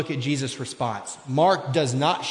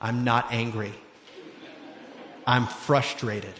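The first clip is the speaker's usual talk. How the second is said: mock calm denial; 6 semitones lower, 7 dB quieter than usual. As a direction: hold it down, insisting you're calm. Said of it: "And I'll go".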